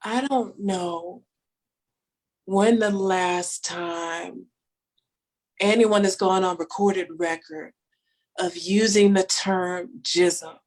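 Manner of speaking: very slow, spaced-out delivery; slow deadpan. Real, not acted. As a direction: drag it out for the punchline. Comic deadpan pause.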